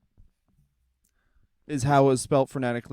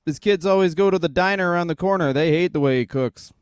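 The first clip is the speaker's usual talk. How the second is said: louder, punchy commentator call; mock ring announcer; 5 semitones higher, 7 dB louder than usual. Mocking Bret Hart's entrance as a hammed-up commentator.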